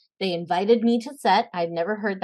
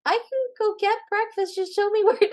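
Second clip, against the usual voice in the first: mock polite protest; 13 semitones higher than usual. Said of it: "I was like".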